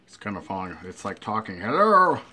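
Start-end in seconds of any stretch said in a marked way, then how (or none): 1.6-2.2 loud hello. Pretend. Burger acts as if it talks.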